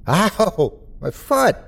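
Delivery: high-pitched yelp of pain, volume held back; acted shout of someone hurt. A narrator's reading. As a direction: cry out in pain, high but contained. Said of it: "he shouted".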